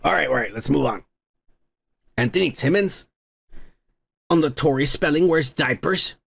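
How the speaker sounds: normal talking voice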